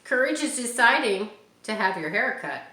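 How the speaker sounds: usual speaking voice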